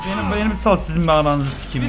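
ordinary talk

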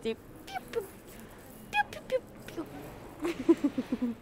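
high, short bird peeps; bird chirping. Shown as a demonstration. Imitates the birdsong just mentioned.

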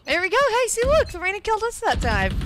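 high, loud excited voice. Real, not acted. Excited during the fight.

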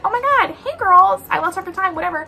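high, mocking valley-girl impression; mocking ditzy voice. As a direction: parody her careless apology. Mocking the seller's reply.

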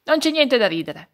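loud, stern rebuke. A narrator's reading. Old Radice Curva scolding the frog, stern and loud. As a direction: scold the laughing frog firmly. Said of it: "disse severamente Radice Curva".

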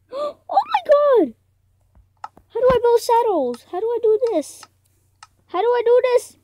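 high, loud panicked yelling; gasp, then cry out high and loud; frantic panic. Real, not acted. Player stuck on how to build saddles, frantic panic.

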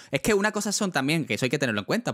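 ordinary talk, nothing marked

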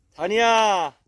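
high, loud coaxing call to a dog. Real, not acted. Owner calling his hiding dog to come out, loud and high. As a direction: coax the hiding dog out from afar.

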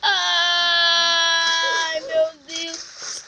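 a sudden fearful shout; scared cry. Real, not acted. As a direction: cry out in fright. Scared in the game. (grito assustado)

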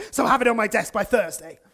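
loud, officious business tone; mock-important office command; fake boss-voice. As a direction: snap into a stern manager voice. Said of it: "their tone of voice changes".

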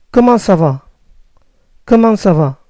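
¿Cómo ça va? said as French "ko-mahn sa va"; French comment ça va, falling. Shown as a demonstration. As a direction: ask it like a Parisian. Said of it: "¿Cómo estás?".

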